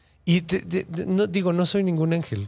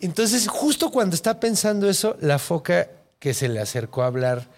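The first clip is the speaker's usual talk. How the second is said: ordinary talk, nothing marked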